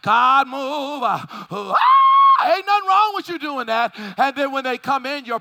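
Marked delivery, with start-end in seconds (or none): 1.0-2.5 a halting "uh, uh" that bursts into a high shouted "ah!"; sudden ecstatic cry. Real, not acted. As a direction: cry out suddenly as the spirit hits. Preacher bursts out in spirit.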